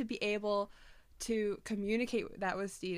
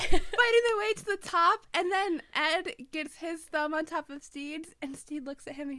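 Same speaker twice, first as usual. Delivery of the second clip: crying; 6 semitones higher, 6 dB louder than usual. Real, not acted. Crying while retelling scene.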